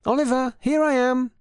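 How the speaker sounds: high, bright hello from a distance; wife cheerfully calls out to her husband; loud cheerful call. Acted. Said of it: "a loud, cheerful voice says".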